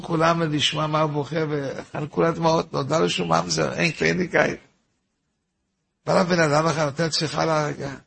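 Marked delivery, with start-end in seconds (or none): none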